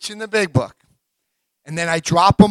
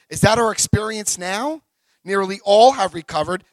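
ordinary talk, nothing marked

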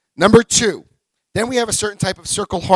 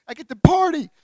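high, rising whoop of joy; gleeful inner cheer; 12 semitones higher, 3 dB louder than usual. Retold or imitated. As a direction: cheer like you just got a day off. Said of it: "that's what I'm thinking".

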